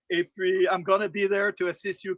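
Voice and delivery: normal talking voice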